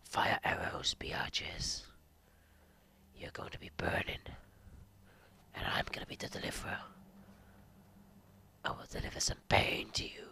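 hushed, breath-only threat; menacing whisper. Pretend. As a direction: whisper it like a stalking avenger. Menacing boast while playing.

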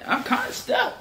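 stuck, voice jumping up high; exasperated. Real, not acted. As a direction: let the frustration lift the pitch. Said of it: "I'm just emoting in real life".

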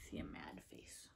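soft whisper; whispered aside. Real, not acted. Reader whispering to young listeners. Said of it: "reader aside".

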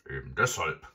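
loud growl; growling robber. A narrator's reading. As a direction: growl it out as a villain. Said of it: "knurrte der Räuber Hotzenplotz".

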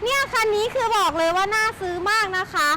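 ordinary talk

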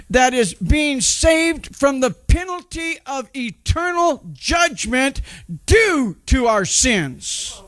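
high-pitched fervent preacher delivery; preaching. Acted. Pastor preaching to the church about being saved. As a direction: preach it with rising fire, word by word.

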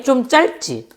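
ordinary delivery

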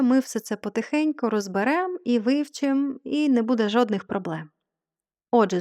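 ordinary talk, nothing marked

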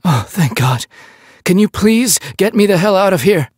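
high, pleading, distinct voice; relief, then begging to be freed; relieved captive. Acted. Captive man begging the French officer to free him.

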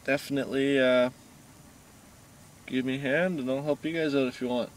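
talking between drags; speaking with cigarette at lips. Real, not acted. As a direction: lips pinched around cigarette. Seen 0: Held cigarette reaches his lips and stays there while speaking.

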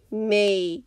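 rasped. A narrator's reading. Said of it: "Skizz rasped out".

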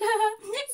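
high rising giggle; a quick giggle at herself; laughing at her own punchline. Real, not acted.

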